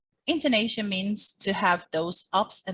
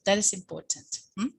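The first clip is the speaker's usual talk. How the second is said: ordinary talk, nothing marked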